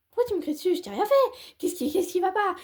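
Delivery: put-on squeaky offended kid; high whiny child. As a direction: plead like a wounded little girl.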